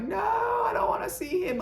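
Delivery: high, pleading no, nearly shouted; panicked cry. Retold or imitated. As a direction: cry out in dread at the screen. Retelling her panic at the scene.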